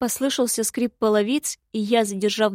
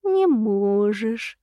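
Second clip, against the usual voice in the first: put-on very slow, hoarse old drawl; hoarse gloating; much slower than usual. A narrator's reading. Stranger mocking the imp's threat, hoarse gloating. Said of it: "хрипло рассмеялся незнакомец".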